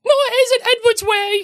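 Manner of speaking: very high put-on whiny voice; hammed-up falsetto objection; mocking falsetto. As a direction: protest in a squeaky mock voice.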